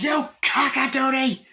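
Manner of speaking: very high, loud shrieking impression; imitating Annie's outburst, very high and loud; mock Annie Wilkes. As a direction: shriek it like a crazed nurse. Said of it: "she goes".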